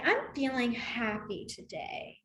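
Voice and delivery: slow, soft, joyless mock-happy; deadpan sarcasm, drawn out and subdued; sarcastic demo. Shown as a demonstration. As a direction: say it slowly and quietly, as if not happy at all. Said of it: "they mean they're being sarcastic".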